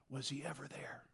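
whispered, hushed doubt; soft whisper of doubt. Retold or imitated. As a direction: breathe the question like a secret fear. Lewis wondering aloud.

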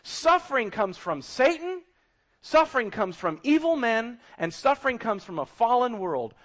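loud, high proclamation; crying out to everybody. Acted. Book of Job standing up to everybody, crying out loudly. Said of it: "screams out to everybody".

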